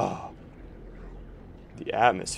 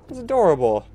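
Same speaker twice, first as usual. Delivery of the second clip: delighted; 8 semitones higher, 7 dB louder than usual. Real, not acted. Hugging cute creature.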